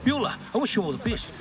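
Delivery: usual speaking voice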